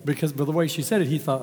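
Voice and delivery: ordinary talk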